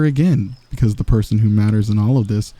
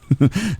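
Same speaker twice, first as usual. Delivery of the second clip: short, higher-pitched teasing laugh; amused chuckle; 7 semitones higher than usual. Acted. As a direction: let out a brief teasing chuckle. Amused villain teasing the listener.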